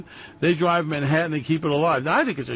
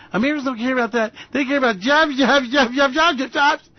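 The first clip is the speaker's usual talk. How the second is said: higher, louder, rapid talking-point chant; mock Kellyanne spin; 7 semitones higher, 3 dB louder than usual. Host mocking Kellyanne's jobs spin, high fast chant.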